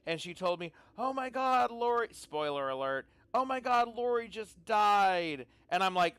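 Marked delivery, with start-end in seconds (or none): 1.0-2.1 higher-pitched mock gasp of news; retold shock. Retells daughter's shocked reaction. 3.3-5.4 louder, higher, drawn-out mock dismay; retold shock. Retells daughter's shocked reaction.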